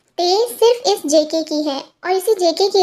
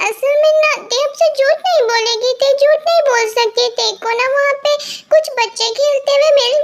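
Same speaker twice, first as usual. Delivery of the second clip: put-on higher, sweet girlish voice with a nervous giggle; cutesy pleading girl; 4 semitones higher than usual. Girl begs boyfriend not to be angry, cutesy and pleading. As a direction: coax him like a guilty little girl.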